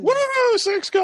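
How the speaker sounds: sudden loud high cheer; excited shout. Real, not acted. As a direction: burst out with it. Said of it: "you scared the heck out of me".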